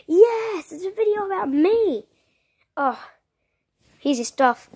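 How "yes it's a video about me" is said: high, loud cheer of joy; excited. Pretend. Foxy cheering that the new video is about him. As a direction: burst out thrilled, much higher.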